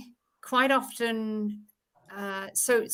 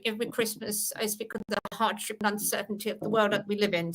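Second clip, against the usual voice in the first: lightly voiced posh royal Christmas speech, tight-lipped; a hint of the Queen, lips tight and quick; posh Queen impression; 5 dB quieter, faster than usual. Shown as a demonstration. Actress demonstrating accents shows the Queen, lightly posh. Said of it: "for a very posh".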